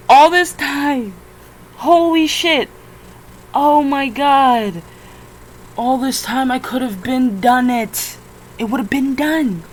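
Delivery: loud, high exasperated rant; loud furious ranting; frustrated outburst. Real, not acted. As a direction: vent in loud disbelief.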